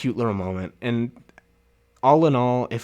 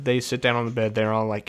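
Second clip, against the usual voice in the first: usual speaking voice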